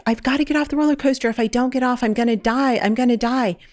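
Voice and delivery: retold panicked yelling. Dreamer retelling her panic on the roller coaster, mock panicked yelling. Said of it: "I'm screaming and I'm yelling".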